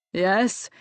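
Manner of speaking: lofty one-word answer, drawn upward. A narrator's reading. Servant answers the dishevelled maid at the door, lofty. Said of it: "he said loftily".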